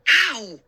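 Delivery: sudden high yelp of pain; sharp ow. Acted. Girl slapping a fly on her arm yelps ow. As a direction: yelp as the fly bite stings.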